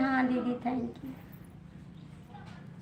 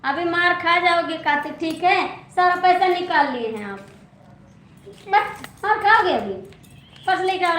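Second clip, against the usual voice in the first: raised, higher-pitched telling-off; scolding; 4 semitones higher, 5 dB louder than usual. Real, not acted. Scolding child who took money. (डाँटते हुए)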